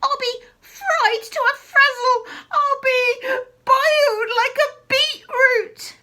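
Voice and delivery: high, loud outraged yell; shrill loud protest. A narrator's reading. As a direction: yell back at George. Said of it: "she yelled".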